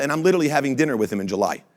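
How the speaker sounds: ordinary talk, nothing marked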